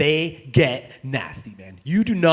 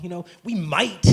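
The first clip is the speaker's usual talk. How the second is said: ordinary talk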